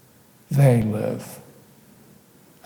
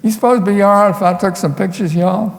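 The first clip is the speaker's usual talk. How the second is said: higher, quick, hammed-up Southern accent; put-on Southern accent, eager question; fake Southern accent; 6 semitones higher, 3 dB louder, faster than usual. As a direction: lay on a fake Southern twang, rattling it off. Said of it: "in my fakest Southern accent".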